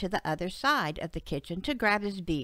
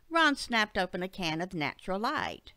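ordinary delivery

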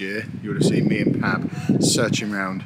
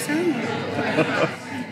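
high burst of laughter; 12 semitones higher, 4 dB quieter than usual. Real, not acted. Laughing at Anna's sadness.

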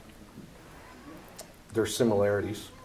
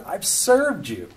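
whiny son; 8 semitones higher, 3 dB louder, slower than usual. Retold or imitated. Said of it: "But here's this kid".

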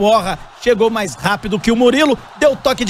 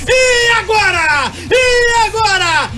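drawn-out taunting shout; 10 semitones higher, much slower than usual. Real, not acted. Taunting after goal.

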